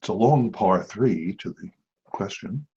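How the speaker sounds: hushed golf commentator; quiet, low, courtside murmur; golf announcer voice. Pretend. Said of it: "That was my golf voice".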